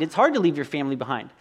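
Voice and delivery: ordinary delivery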